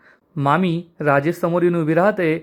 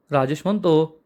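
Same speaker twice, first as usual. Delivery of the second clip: usual speaking voice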